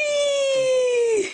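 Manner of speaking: high, drawn-out excited squeal; playful whee. Real, not acted. Playing airplane with the baby.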